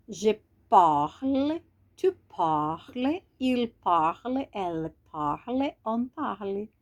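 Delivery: very slow, each form drawn out; slow conjugation drill. Shown as a demonstration. Teacher to students, reciting the conjugation very slowly. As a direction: drill the forms slowly for learners to copy. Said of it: "Então, vamos lá".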